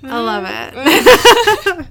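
slow, high and loud, laughing through it; laughing delight. Real, not acted. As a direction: squeal it out with glee. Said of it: "laughing delight".

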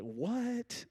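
a high, incredulous "What?"; mock surprise. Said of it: "I know you guys are like".

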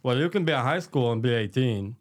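lisping creepy man impression; heavy lisp, voice unlike his own; thick lisp. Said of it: "he has a really thick, um, lisp. He goes like this".

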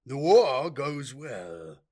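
slow, loud, lifeless pronouncement; cold, dead voice. Acted. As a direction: speak like a corpse on a throne. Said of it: "in his cold, dead voice".

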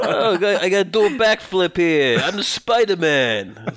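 put-on loud, high New York accent; New York Spider-Man. Auditioning for a Spider-Man cartoon, hammy New York accent. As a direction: play a cartoon hero in broad New York tones. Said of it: "me doing an audition for Spider-Man".

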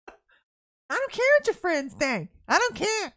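high, drawn-out parental scolding; mock scolding parent. Parent scolding child in retold exchange.

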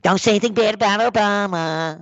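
mocking put-on liberal voice; phony liberal. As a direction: mimic a scandalized liberal scolding you. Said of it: "liberals we have up here near Woodstock".

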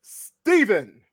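stretched ring announcer call; grand entrance announcement. Acted. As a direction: drag out each other like a boxing MC. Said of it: "That was the best intro ever".

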